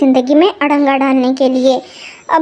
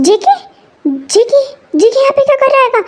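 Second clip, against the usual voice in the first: higher, fast, stunned question; shocked mother; 7 semitones higher than usual. Acted. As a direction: gasp it out in disbelief. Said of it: "shock होने की ज़रूरत नहीं है".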